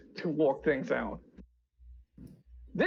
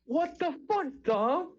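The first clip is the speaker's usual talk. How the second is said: shocked; 12 semitones higher than usual. Real, not acted. Shocked outburst.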